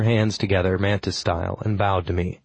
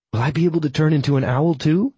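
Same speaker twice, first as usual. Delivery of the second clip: put-on young woman voice; 7 semitones higher than usual. A narrator's reading. Granuaile voiced by narrator.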